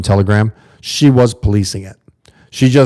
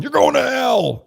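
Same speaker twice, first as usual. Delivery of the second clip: high, slow, hammed-up warning of hell; a shrill preacher's cry, mocked; mock street preacher; slower than usual. Imitating street preachers yelling at the crowd, high and slow.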